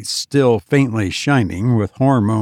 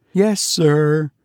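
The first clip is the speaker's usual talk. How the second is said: put-on higher, pleading boy voice; eager young boy; 4 semitones higher than usual. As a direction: play an excited young son. Boy answers father.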